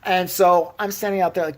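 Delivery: usual speaking voice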